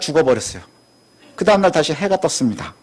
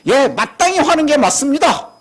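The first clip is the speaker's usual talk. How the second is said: higher, sure of himself, mildly acted; confident reply; 8 semitones higher than usual. Jonah answering God, certain he is right, higher and sure. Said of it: "확신에 가득 차 있어요".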